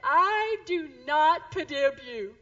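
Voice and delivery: slow, loud mock toddler protest; upset little boy. Crying three-year-old with a skinned knee tells mom off, mock upset. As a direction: imitate an indignant small child's baby talk. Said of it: "he was so upset".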